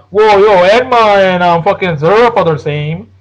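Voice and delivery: lightly voiced arguing fan; hint of a drawn-out mocking protest; mock objector. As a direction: imitate a fan butting in to object. Acting out people who will debate.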